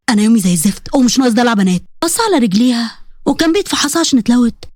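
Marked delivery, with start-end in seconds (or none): 0.1-1.8 low-pitched male grumble; irritated husband. Acted. Husband rebuking his injured bride, irritated and low. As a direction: cut off her fuss in a low, impatient voice.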